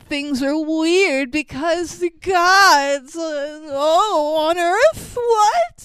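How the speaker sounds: high, loud hammed-up wail; mock dramatic wail. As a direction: ham up cosmic melodrama. Mocking dramatic tilted scene.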